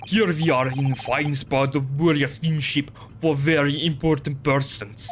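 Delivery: gruff Russian sailor, proud; broken English with a heavy Russian accent. Acted.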